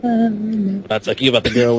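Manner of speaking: ordinary talk, nothing marked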